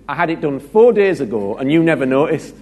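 high, loud mock wife complaint; playing the hurt wife who was ignored.

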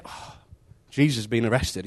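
ordinary talk

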